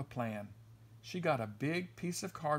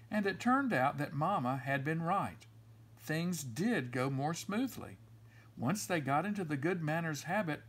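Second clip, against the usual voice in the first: ordinary delivery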